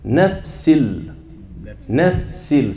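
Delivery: Nafsil, noon swallowed into the lam; nafsil with the noon fully assimilated. Shown as a demonstration. Teacher modelling Nafsil for the class, noon fully assimilated. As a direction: say Nafsil with no n sound, straight into l. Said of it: "it should sound like this".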